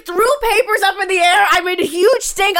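loud and laughing, high-pitched; telling it at full volume, cracking up; excited. Real, not acted. Retelling her screaming fit, laughing.

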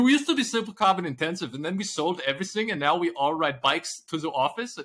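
a mock Dutch executive, fading back toward normal; bad Dutch accent. Mocking a Dutch company's boasts in a bad Dutch accent. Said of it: "I thought Dutch".

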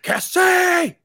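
high loud yell of a name. Retold or imitated.

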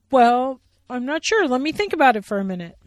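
loud, high, fast unsure bear; Billy Bear voice. Acted. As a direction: think out loud hurriedly. Billy thinking.

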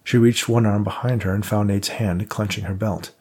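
plain reading voice

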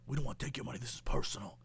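low, hushed, solemn tough guy; mock mobster. Old mob friends answer Nick Cage, low hushed mock menace. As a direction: say it very low and soft, movie-gangster gravity.

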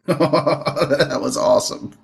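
slow, laughing delight. Real, not acted. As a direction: laugh through the words. Laughing at a crazy spot.

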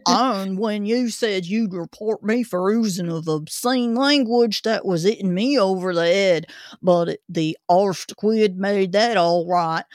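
put-on gruff old Cockney zookeeper; Cockney accent. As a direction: grumble it like a London keeper. Zookeeper character continues.

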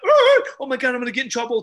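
high, loud gasping alarm; imitating someone in mock panic; panicked cashier. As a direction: squeal it, then tumble on. Cashier panics about manager.

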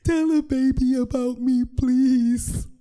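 hammed-up hysterical deathbed pleading; hysterical crying. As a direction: wail a last plea through mock sobs. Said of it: "I'm crying now. I'm hysterical".

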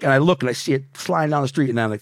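normal talking voice

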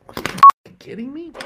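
loud, high, disbelieving outburst; incredulous yell. Retold or imitated. Tough-love study partner yelling at the crier, loud and high. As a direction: blow up at him in disbelief. Scolding the crying classmate.

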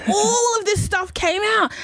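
high, slow words through a real laugh; laughing disbelief. Real, not acted. To her co-host, laughing at how bad the car was.

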